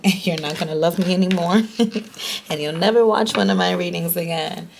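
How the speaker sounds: laughing while talking. Real, not acted. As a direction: laugh through the words. Joking her viewers will leave.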